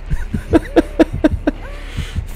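long, high, voiceless laugh; high laughter. Real, not acted. Player left lying on the ground, helpless wheezing laugh.

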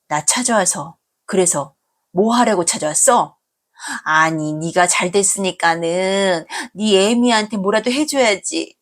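ordinary delivery, nothing marked

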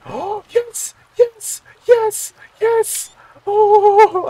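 very high, loud squeals of yes; gasping, ecstatic shriek; freaking out. Real, not acted. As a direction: squeal with uncontained delight. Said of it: "I'm just freaking out right now".

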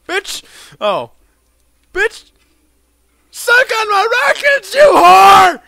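high, very loud screamed taunts; screaming outburst. Pretend. Player firing rockets at game enemy, high screamed taunts. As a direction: scream it as you fire on the enemy. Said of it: "I apologize for my outburst".

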